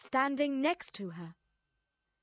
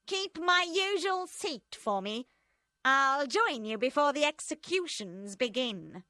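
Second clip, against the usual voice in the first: higher, louder cold voice; scheming Madame Defarge; 5 semitones higher, 4 dB louder than usual. A narrator's reading. Madame Defarge telling friends her plan, cold and loud. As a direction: plot it out coldly.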